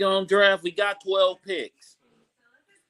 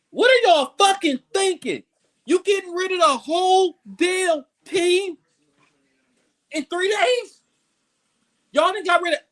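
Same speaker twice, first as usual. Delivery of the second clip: furious yell; 9 semitones higher than usual. Real, not acted. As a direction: yell at the front office in disbelief. Furious rant at roster cuts.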